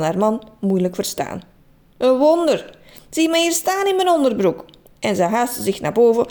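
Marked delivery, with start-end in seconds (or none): 2.0-4.6 indignant. Acted. Trudy indignant at her husband.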